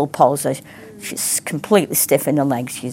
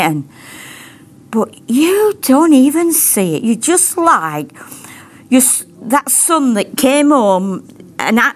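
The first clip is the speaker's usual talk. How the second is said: impassioned praying, pitched higher; fervent prayer; 4 semitones higher than usual. Real, not acted. Preacher thanking the Father for his love, fervent prayer. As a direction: pour out earnest thanks to God.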